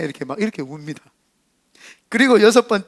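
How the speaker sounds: ordinary delivery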